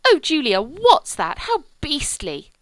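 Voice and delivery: high, loud dismay; shocked outcry. A narrator's reading. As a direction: cry out in horror at it. Cordelia shocked at the tortoise.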